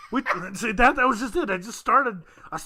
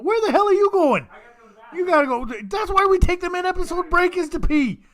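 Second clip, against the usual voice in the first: surprised call out; 11 semitones higher, 4 dB louder than usual. Real, not acted. Co-host leaving mid-recording.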